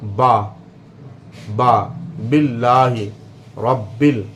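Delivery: बा with the moist inner lips pressed together; teacher sounds out बा and बिल्लाहि रब्बिल; demonstrated ba. Shown as a demonstration. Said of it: "तो इससे बे अदा होता है".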